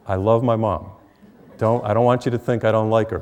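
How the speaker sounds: normal talking voice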